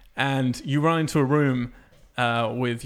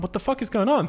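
baffled outburst; 8 semitones higher than usual. Retold or imitated. Retelling confusion at the game.

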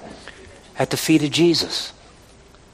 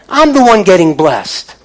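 high, loud climactic preaching; rising sermon climax; preaching at full force; 7 semitones higher, 6 dB louder than usual. Acted. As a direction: lift the congregation up.

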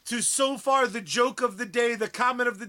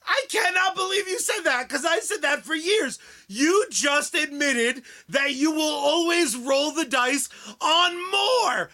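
triumphant shout; 5 semitones higher than usual. Real, not acted. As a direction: crow over winning the argument. Gloating win over cohost.

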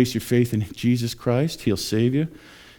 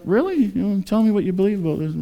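high-pitched acted curiosity; intrigued listener; 10 semitones higher than usual. Somebody comes up to the preachers, intrigued and surprised. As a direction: ask as if newly intrigued. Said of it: "somebody comes up".

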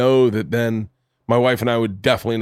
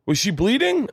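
alarmed doctor; 9 semitones higher than usual. Retold or imitated.

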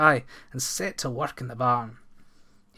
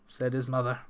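usual speaking voice

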